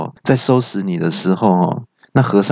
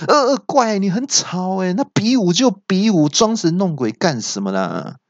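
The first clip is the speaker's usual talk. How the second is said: high-pitched, exasperated call; annoyed shout; 9 semitones higher than usual. A narrator's reading. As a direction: call out high, fed up with the noise. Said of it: "我就大喊说". (不耐烦地高声喊)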